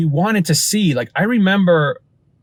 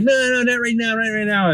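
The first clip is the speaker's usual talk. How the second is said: higher, lightly voiced evasive kid; kid brushing off; 8 semitones higher than usual. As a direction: dodge the question like a fibbing child. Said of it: "And he just kept like".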